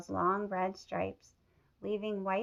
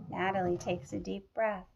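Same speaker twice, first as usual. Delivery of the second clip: ordinary delivery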